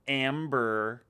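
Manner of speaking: Amber with a hard, drawn-out American r; mock American, leaning on the final r; exaggerated American accent. Australian girl mimicking how Americans say Amber, exaggerated American accent. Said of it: "But the Americans always go".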